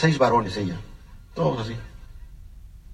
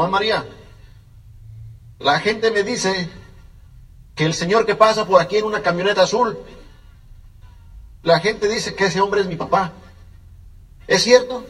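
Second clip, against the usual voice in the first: put-on young boy asking; 5 semitones higher than usual. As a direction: plead like a small boy wanting the truth. Child asks grandmother about father. (niño suplicante, agudo y lento)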